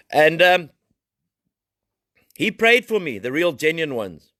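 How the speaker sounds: fiery preaching. Acted. Preaching voice resumes.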